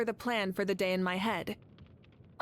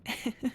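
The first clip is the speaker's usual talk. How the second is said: a short, affectionate laugh; fond laugh; 3 semitones higher than usual. Acted. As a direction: laugh at the sweet memory. Fond memory of sister and Saurian.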